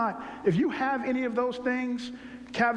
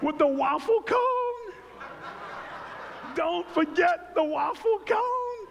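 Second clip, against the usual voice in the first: slow, much higher, laughing cry about the cone; laughing shout; 9 semitones higher, slower than usual. Acted. Laughing about ice cream cone.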